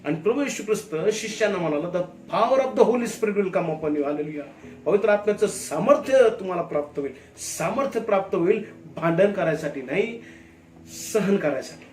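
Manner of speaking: forceful preaching. Real, not acted. Preacher tells the congregation of the Holy Spirit's power, forcefully. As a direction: drive each key word home loudly.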